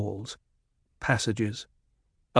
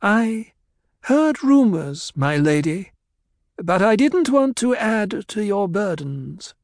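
meek doctor; 10 semitones higher, 4 dB louder than usual. A narrator's reading. Doctor answers meekly.

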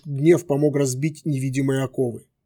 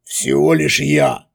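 sly villain voice; 3 dB louder, slower than usual. A narrator's reading.

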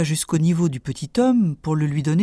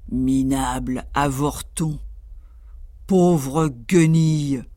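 slow, lower scornful giant voice; disdainful giant; 3 semitones lower, slower than usual. Acted. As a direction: sneer down at him slowly. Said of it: "le considéra dédaigneusement et dit".

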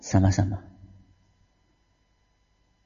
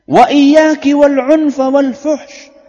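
loud; 8 semitones higher, 9 dB louder, much slower than usual. Acted. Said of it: "Apa kata Nabi".

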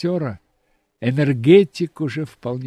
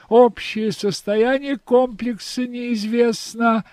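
put-on old speech synthesizer; 9 semitones higher, 4 dB louder than usual. A narrator's reading. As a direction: speak like a mechanical robot reporting status. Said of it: "синтезированный голос механоида". (высокий медленный синтезированный голос робота)

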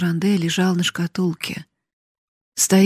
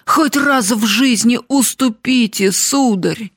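higher, raised urging; servant's cry; 5 semitones higher, 4 dB louder than usual. A narrator's reading. Servant pleading with her master to yield, crying out. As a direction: cry out, urging him to give in. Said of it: "закричала Нанетта".

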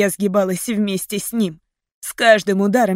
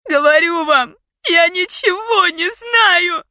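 high, loud, drawn-out plea; screaming through tears; 10 semitones higher, 5 dB louder, slower than usual. A narrator's reading. Heroine pleading with captor, tearful scream. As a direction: wail it, desperate to be believed. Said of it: "закричала я сквозь слёзы".

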